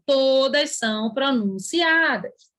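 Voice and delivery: "todas" and "pronunciadas" drawn out slowly; slow, weighty stress on "todas"; emphatic observation. Shown as a demonstration. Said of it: "eu coloquei aqui a observação".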